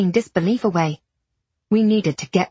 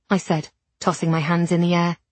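plain reading voice

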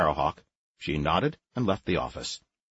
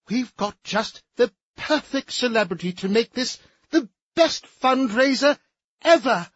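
much higher, fluttery society matron; warbling high voice; 13 semitones higher, 3 dB louder than usual. Acted. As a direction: warble it an octave up. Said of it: "in a voice at least an octave above her natural range".

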